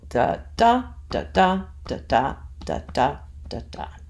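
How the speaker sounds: "da DA" repeated evenly, weight on each second DA; iambic beat. Shown as a demonstration. Teacher shows the rhythm of iambic feet, iambic beat. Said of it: "That's an iamb".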